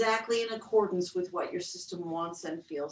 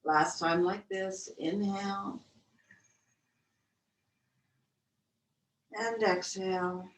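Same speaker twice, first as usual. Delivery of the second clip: guided breath count. Acted.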